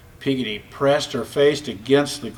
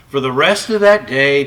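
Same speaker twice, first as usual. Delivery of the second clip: ordinary delivery, nothing marked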